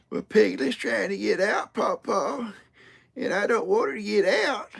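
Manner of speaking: high-pitched whiny-child imitation; imitacja jej głosu. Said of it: "that's the way she sounds".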